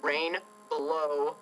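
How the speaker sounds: very slow whispery wording, word by word; hushed and deliberate, with gaps; slow, hushed announcement. Real, not acted. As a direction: draw out each word softly. Spells out odd name slowly.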